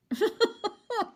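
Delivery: high laugh. Real, not acted. Laughs at own joke.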